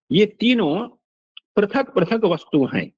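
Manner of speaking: ordinary talk, nothing marked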